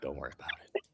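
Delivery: hushed whispered aside. Pretend. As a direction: breathe it conspiratorially. Whispered aside after joke.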